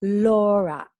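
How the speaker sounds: ordinary talk, nothing marked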